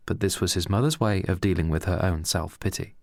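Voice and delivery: ordinary delivery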